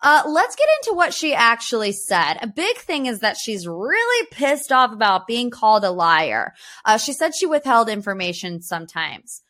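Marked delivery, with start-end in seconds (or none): none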